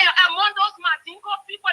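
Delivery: normal talking voice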